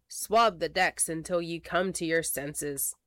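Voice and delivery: ordinary delivery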